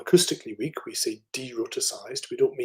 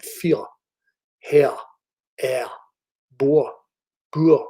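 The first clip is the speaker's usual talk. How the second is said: louder demo words with a very weak final R, "hair" as /hɛ/; vernacular Scottish accent; 2 semitones higher, 7 dB louder, slower than usual. Shown as a demonstration. Lecturer demonstrates working-class Scottish words with weak R. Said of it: "which I'll attempt to produce for you".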